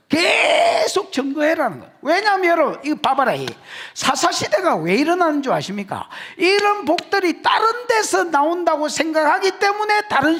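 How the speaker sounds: high, forceful sermon cry with stretched key words; impassioned preaching. Real, not acted. Preacher urging congregation to proclaim God's grace, impassioned preaching. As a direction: drive each point home in a high preaching voice.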